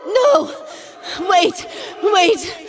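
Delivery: desperate cry. Acted. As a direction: scream to stop them, terrified. Pleading to stop an execution.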